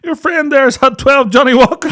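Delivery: high, laughing through the words; real laughter telling the punchline. Real, not acted. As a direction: squeal it out laughing.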